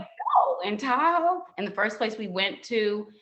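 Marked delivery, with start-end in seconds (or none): 0.0-1.4 higher, incredulous no; can't believe he suggested it; shocked refusal. Retold or imitated. Turning down her partner's idea of a Tahoe wedding, incredulous.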